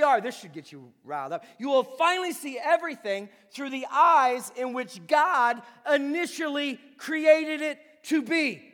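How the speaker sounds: loud, steady preaching cadence. Acted. Pastor promising the congregation new eyes, rousing preaching. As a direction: drive each finally home.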